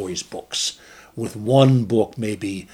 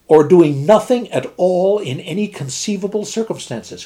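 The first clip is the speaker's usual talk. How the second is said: louder, triumphant exclamation; cried out; 9 semitones higher, 6 dB louder than usual. Acted. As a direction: seize on the point with glee. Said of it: "cried the captain".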